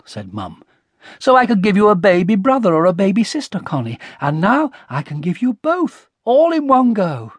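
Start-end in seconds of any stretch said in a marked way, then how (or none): 1.2-7.3 higher, excited explanation; enthusiastic. A narrator's reading. Mum telling Connie about twins, enthusiastic. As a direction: gush with delight at the news.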